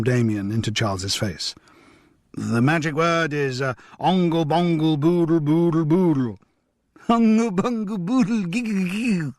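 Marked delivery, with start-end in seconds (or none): 2.3-6.4 lightly sing-song nonsense chant; playful nonsense. Acted. Grandfather inventing a silly magic word, lightly sing-song. 7.1-9.3 put-on high giggly little boy; child voice. Little Julian repeating the silly word, high and giggly. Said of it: "Julian repeated".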